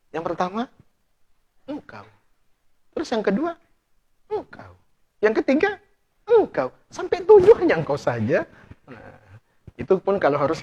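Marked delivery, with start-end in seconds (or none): 0.1-8.4 rising romantic build. Retold or imitated. Husband's clever romantic answer.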